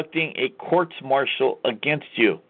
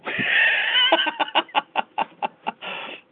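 shrill mocking cackle, much higher than his voice; high cackling laugh. Real, not acted. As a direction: burst into a high scornful laugh. Mocking the judge's admission.

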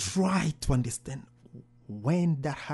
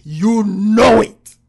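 loud, slow, weighty command; slow booming voice of God; booming divine voice; 6 semitones higher, 15 dB louder, much slower than usual. Retold or imitated. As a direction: proclaim it as God. God's voice retold, booming.

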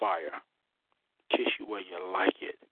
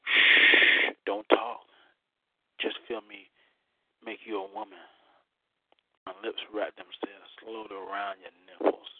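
right in your ear; 6 semitones lower, 7 dB quieter than usual. Acted. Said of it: "as I whisper in your ear".

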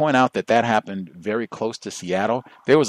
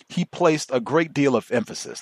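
ordinary talk

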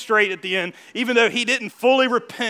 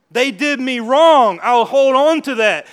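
loud, slow refusal; louder, slowed, defiant; stubborn grudge; 3 semitones higher, 10 dB louder, slower than usual. Pretend. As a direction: dig in and hold the grudge. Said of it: "now all of a sudden it's".

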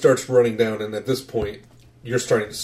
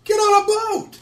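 put-on hysterical kid. Frantic kid to pursuers at the docks, hysterical. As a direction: yell it as a panicked youngster.

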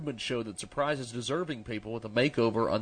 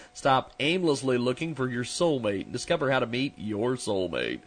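usual speaking voice

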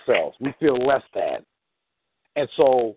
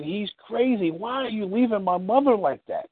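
higher-pitched mock protest; upset wife; 6 semitones higher than usual. Wife questions husband leaving her mother, in mock upset. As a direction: lightly voice an indignant wife.

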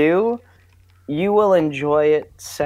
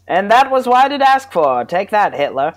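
high, loud vintage radio announcer; old-timey radio voice; 5 semitones higher, 4 dB louder than usual. Pretend. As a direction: ham it up like a 1940s radio host. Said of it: "an old-timey radio voice".

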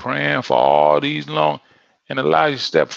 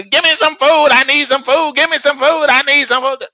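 much higher, loud whining yell; screaming child; 13 semitones higher, 8 dB louder than usual. Retold or imitated. Child yelling at parents for food, shrill and loud. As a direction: shriek it like a demanding child. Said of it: "say it over and over".

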